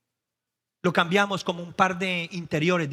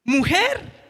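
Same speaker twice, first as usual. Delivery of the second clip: higher, excited pulpit shouting; fervent revival preaching; preaching at full force; 8 semitones higher, 6 dB louder than usual. Acted. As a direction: stir the crowd up.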